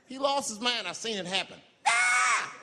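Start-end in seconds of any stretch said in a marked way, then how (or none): 1.9-2.5 loud, high war cry; battle scream. Retold or imitated. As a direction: charge with a yell. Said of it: "He screamed out".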